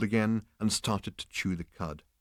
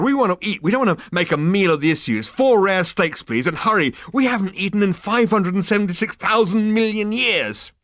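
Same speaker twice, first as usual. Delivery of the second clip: higher, louder brash orders; Zaphod demanding; 12 semitones higher, 9 dB louder than usual. Acted. Zaphod ordering steaks from the animal, loud and brash. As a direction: bark the order impatiently.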